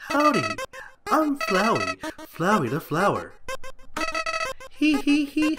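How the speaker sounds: high, squeaky flower voice; Flowey voice. Acted. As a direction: play the cheerful little flower, way up high. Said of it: "can I do the voices".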